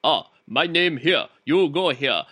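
slow, halting imitation of a Japanese accent; caricatured Japanese-accented English; mock Japanese accent. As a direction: put on a rough Japanese accent, word by word. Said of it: "a Japanese accent".

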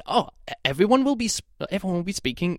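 high, exaggerated British accent; hammed-up, very proper British accent; perfect British accent. Reviewer imitating the book's characters in an exaggerated British accent. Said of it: "in a perfect British accent".

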